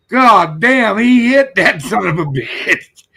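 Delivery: high, loud, drawn-out exclamation; imitated boy's amazement. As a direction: act out an awestruck kid blurting it out. Retelling a boy's amazed outburst.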